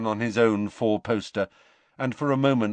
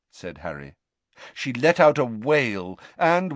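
plain reading voice

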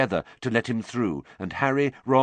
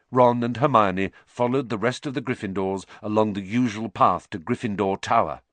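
plain reading voice

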